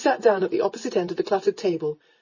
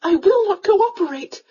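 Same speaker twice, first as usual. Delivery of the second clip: high, loud, alarmed refusal; gasped protest; 11 semitones higher, 5 dB louder than usual. A narrator's reading. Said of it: "she gasped".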